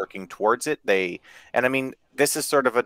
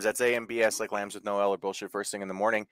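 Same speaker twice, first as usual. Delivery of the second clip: ordinary delivery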